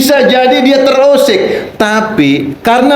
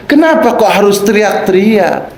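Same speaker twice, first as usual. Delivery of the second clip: normal talking voice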